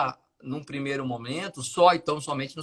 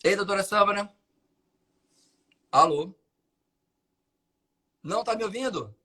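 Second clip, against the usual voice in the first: raised, higher calls into a dead line; to someone hard of hearing; 3 semitones higher than usual. Real, not acted. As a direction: call out to check she's still there. Call drops, guest can't hear.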